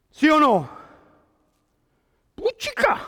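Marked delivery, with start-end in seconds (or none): 2.4-3.0 high-pitched, put-on "street talk" outburst; mock exclamation. Preacher jokes about street language, mock high-pitched exclamation. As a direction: squeak out a mock swear word.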